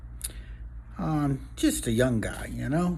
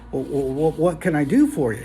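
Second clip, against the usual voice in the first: stammering, mildly nervous; nervous bartender; 3 dB louder than usual. Acted. Bartender facing a huge customer, nervous stammer. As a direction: stumble over the first words before asking. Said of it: "he's kinda nervous now".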